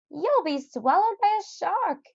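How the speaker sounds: high, alarmed neighbour's warning; Missus Seed Nibbler warning. Acted. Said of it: "said Missus Sea Nibbler".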